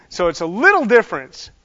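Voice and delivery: usual speaking voice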